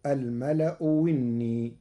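"وني" opened on a pure w; hamza as waw. Shown as a demonstration. Sheikh shows Warsh's second option, "وني" starting with waw. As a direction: say "الملأ وني" as al-mala'u winni. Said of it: "وإبدالها واوا خالصة".

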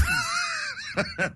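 real burst of laughter. Real, not acted. Host reacts to listener message.

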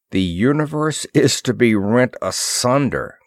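ordinary delivery, nothing marked

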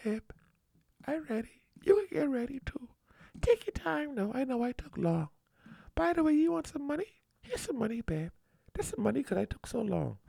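soft, high, hushed sweetness; still small voice. Pretend. Wife to husband, apologising for being late, in a still small voice. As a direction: whisper it meekly like a sorry husband. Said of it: "say in a still small voice".

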